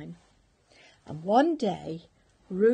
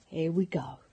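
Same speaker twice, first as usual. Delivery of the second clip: usual speaking voice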